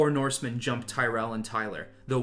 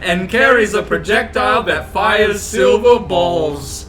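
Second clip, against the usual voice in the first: higher, louder explaining, slowing at end; urgent knight; 9 semitones higher, 12 dB louder than usual. Acted. Knight urgently explaining the threat to the king. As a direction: press the point hard.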